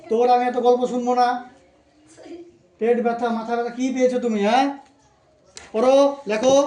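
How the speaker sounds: fed-up tutor yelling at a pupil; angry scolding. Real, not acted. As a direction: shout down the excuses. Tutor scolds child's excuses.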